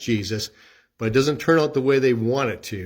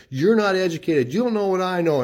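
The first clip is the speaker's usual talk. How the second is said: condescending put-down; 7 semitones higher, 3 dB louder than usual. Retold or imitated.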